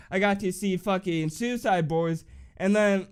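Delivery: ordinary talk, nothing marked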